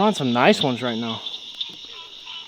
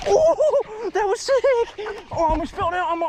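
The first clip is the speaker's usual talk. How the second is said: excited. Real, not acted. Angler to viewers after a big topwater bite, thrilled high cry. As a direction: yelp it out, blown away by the strike. Angler reacting to a bass strike.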